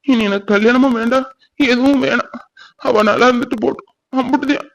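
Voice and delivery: high, crying voice of someone giving up; tearful despair. Acted. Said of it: "என்னால தாங்கவே முடியல". (தாங்க முடியாத அழுகைக் குரல்)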